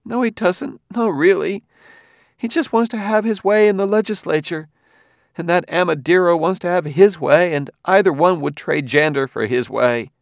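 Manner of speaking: high, insistent voice; Gladia, protesting. A narrator's reading. Gladia disputes Bailey's word about Doctor Fastolf, protesting. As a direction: contradict in a very high voice, easing down.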